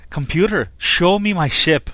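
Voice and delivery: playing Scotty, barking at the computer in a Scottish accent; Scotty's Scottish accent. Scotty ordering the ship's computer, in a Scottish accent. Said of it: "I can't do the accent, so I'll let you try it".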